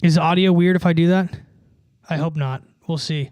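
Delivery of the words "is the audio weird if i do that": loud. Real, not acted. Testing changed audio setup.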